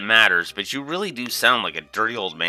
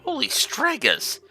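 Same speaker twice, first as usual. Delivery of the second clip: much higher, slow gasp of wonder; amazed exclamation; slower than usual. Acted. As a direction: blurt it out in awe. Awed at the fancy room.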